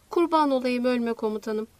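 usual speaking voice

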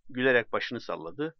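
ordinary delivery, nothing marked

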